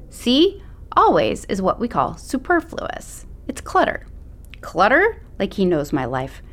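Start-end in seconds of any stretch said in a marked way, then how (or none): 0.1-4.1 higher, preachy teacher voice; lecturing teacher. Acted. Teacher lecturing in narrated scene. 4.6-5.2 high, rising disbelief; incredulous echo. Acted. Macy incredulous at the word.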